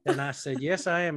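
Southern preacher accent, mock earnest; lightly hammed Southern televangelist; Joel Osteen. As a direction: answer humbly in a put-on Southern accent. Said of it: "That's a really good accent".

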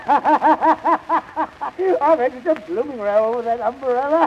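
helpless laughter breaking up the words; laughing. Acted. Master owning up to his needless row, laughing.

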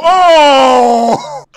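loud high startled cry; startled yelp. Real, not acted. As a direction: yelp as if jumped. Startled by a stranger.

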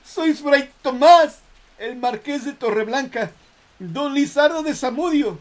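shrieking, disbelieving horror; terrified shout. Acted. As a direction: cry out in panic. Man recognizes dead judges. (grito aterrado de incredulidad)

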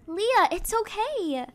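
usual speaking voice

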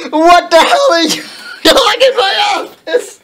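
high-pitched hammed-up yell breaking into laughter; mock angry shout. A narrator's reading. Reader re-voices Dumbledore finding intruders in the office, mock angry shout. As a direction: yell it in over-the-top outrage. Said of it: "He began to shoot angrily".

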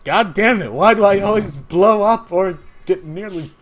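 loud, high-pitched rant; exasperated shouting. Acted. Character rants about always blowing up, exasperated shouting. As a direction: complain at full volume.

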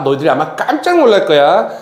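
ordinary delivery

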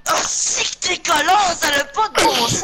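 high indignant outburst; raging gamer. Real, not acted. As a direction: rage at being shot, pitch up. Outraged at teammate shooting him.

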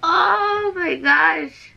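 slow, tearful voice with a laugh; crying. Real, not acted. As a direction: draw it out, overwhelmed and half-laughing through tears. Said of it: "I'm crying!".

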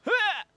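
short, high, loud "ha"; sharp mock battle yell; attack yell. Player attacking with crabs, sharp attack yell.

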